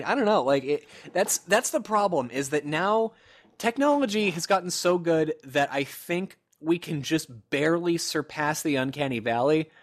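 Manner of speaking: normal talking voice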